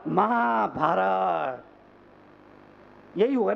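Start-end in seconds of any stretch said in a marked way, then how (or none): 0.1-1.6 slow, low, stretched-out reveal; drawn-out punchline. Real, not acted. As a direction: drop low and stretch the word out. Punchline after the build-up. (धीमे, नीचे सुर में खींचकर)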